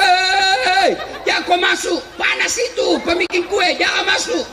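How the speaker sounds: high-pitched mock yell of warning; retold yell. Villager warning someone off the lift, high mock yell. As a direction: imitate a villager crying out in alarm, pitched high. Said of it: "Dia teriak".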